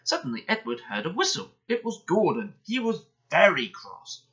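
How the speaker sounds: plain reading voice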